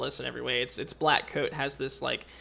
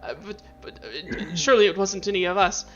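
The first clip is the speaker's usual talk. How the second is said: frightened stammer; 5 semitones higher, 4 dB louder than usual. Acted. Terrified acolyte answers angry master.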